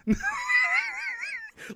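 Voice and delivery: high squeal of laughter. Real, not acted. Laughing at his own slip.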